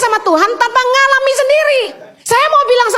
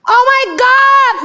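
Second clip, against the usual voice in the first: excited exclamation; 4 semitones higher, much slower than usual. Real, not acted. Exclaims about answered prayer.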